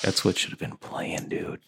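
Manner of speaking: whispered aside; whispering. Real, not acted. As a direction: whisper it to the side. Aside after humming.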